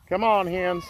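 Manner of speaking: high coaxing call to hens; calling chickens. Real, not acted. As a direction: coax the hens out.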